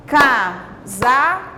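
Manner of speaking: "Casaco" slowly, CA, pause, SA, pause, CO; syllable by syllable. Shown as a demonstration. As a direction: count out the three syllables of "Casaco". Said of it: "temos três sílabas". ("Casaco" devagar, com pausa entre as sílabas)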